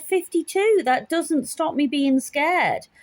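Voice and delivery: high, indignant comeback; retold scared retort. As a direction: protest being told off, drawn out. Acts out retort when called ridiculous.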